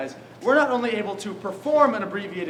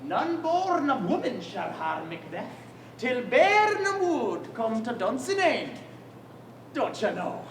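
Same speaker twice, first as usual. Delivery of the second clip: put-on Scottish accent, big swoops up on beware, slow prophecy; Scottish accent, slow and hammed up; hammy Scottish accent; 2 semitones higher, slower than usual. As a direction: intone the warning like a Scottish seer. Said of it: "in perfect Scottish accent".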